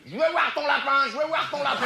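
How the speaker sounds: put-on high, whiny child demanding; insistent little girl. Little girl pestering the grandmother, high and insistent. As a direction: nag like a stubborn little girl.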